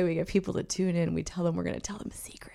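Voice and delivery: fast conspiratorial whisper. Real, not acted. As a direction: lean in and whisper it. Confiding about the secrets.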